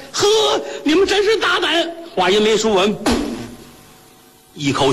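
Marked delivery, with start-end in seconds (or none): 0.1-1.9 very high outraged exclamation; outraged cry. Acted. Wife rebuking the shopkeepers, high outraged cry. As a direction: hurl it at them in outrage.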